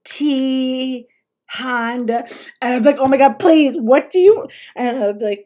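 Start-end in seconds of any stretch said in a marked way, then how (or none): 0.1-2.2 drawn-out words with gaps; slow, word-by-word typist imitation; imitating slow typing. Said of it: "he types, like, slow as shit". 3.0-4.5 exasperated plea, loud and higher; pushed-up, exasperated begging; retold frustration. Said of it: "I would get real frustrated".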